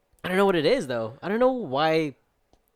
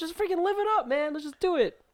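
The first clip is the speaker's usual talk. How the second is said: much higher, put-on hyped-up voice; party hype. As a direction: sell it like a reckless party friend. Acting out friend's party mood.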